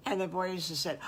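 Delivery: ordinary delivery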